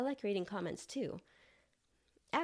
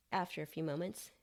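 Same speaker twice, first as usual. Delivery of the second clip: ordinary delivery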